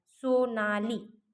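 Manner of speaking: सोनाली said slowly, the final ली held long; drawn-out demo word. Shown as a demonstration. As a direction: stretch सोनाली so its ending ई rings out. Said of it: "ली म्हणताना ईचा उच्चार होतो".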